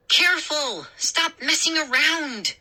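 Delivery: high urgent warning; sharp worried warning. A narrator's reading. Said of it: "Mindy warned".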